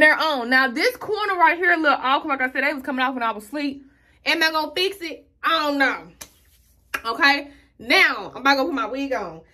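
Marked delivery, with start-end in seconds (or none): none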